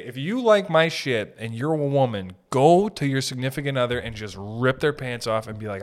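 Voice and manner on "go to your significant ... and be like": ordinary talk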